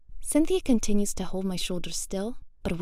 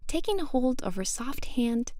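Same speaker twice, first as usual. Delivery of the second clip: ordinary delivery, nothing marked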